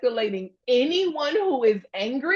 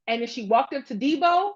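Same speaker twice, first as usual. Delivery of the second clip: ordinary delivery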